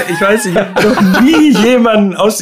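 laughing. Real, not acted. Laughing about embarrassment.

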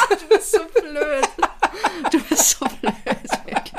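durch Lachen gesprochen; lachend. Real, not acted. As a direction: lachend schimpfen, halb amüsiert. Lacht über den Witz des Partners.

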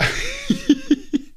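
short high-pitched laugh. Real, not acted. Laughs after joking address. (hohes Lachen)

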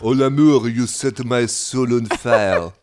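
loud, slow, hammed-up French lover; French accent. Tom wooing a lady cat in a French accent. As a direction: croon it like a French cartoon cat. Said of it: "dann hat er immer mit einem französischen Akzent gesprochen".

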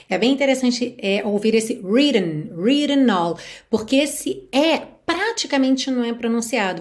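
normal talking voice

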